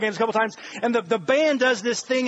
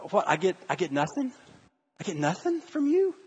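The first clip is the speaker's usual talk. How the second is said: soft, questioning, acted hurt; hurt disbelief; 5 semitones lower, 5 dB quieter than usual. God to an unresponsive worshiper, softly incredulous. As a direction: ask it quietly, rising in disbelief.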